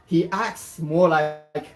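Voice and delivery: slow, swooping picture-book narration; story read-aloud. Acted. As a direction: stretch the key words and let the pitch swoop.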